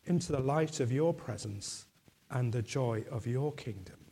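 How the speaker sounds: ordinary delivery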